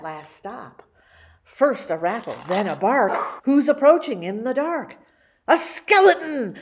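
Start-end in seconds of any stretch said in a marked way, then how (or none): 5.5-6.6 high, loud announcement; excited reveal. A narrator's reading. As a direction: shout out the reveal. Skeleton reveal in story.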